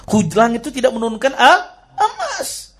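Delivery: Umar's rebuke. Retold or imitated. Preacher quotes Umar on gold from the sky, high exclaimed rebuke. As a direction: exclaim it high, leaving a gap before the final word. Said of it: "kata Umar".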